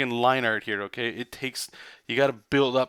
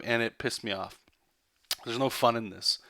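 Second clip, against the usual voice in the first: ordinary talk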